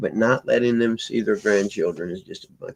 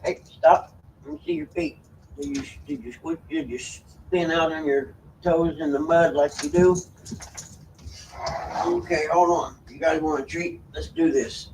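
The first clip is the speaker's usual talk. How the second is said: high-pitched talk to a pet; high, coaxing pet-owner voice; pet-directed talk; 8 semitones higher than usual. Real, not acted. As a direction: fuss over the animals in a high voice. Fussing over pets, offering a treat.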